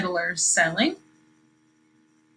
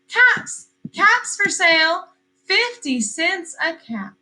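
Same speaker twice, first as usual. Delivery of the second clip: peddler's street call; 7 semitones higher, slower than usual. Acted. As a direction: call it down the street to sell caps. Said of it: "he called".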